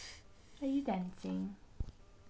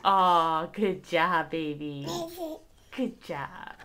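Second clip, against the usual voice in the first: warm praise; 9 semitones lower, 6 dB louder than usual. Real, not acted. Praising the toddler softly.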